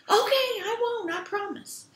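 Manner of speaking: very high, quick promise; high-pitched, eager agreement; eager little billy goat. Acted. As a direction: pipe up in a tiny goat voice. Little goat answers the troll.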